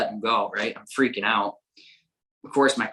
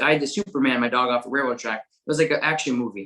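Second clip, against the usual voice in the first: normal talking voice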